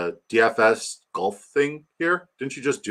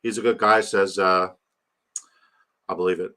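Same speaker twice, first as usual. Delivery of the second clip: ordinary talk, nothing marked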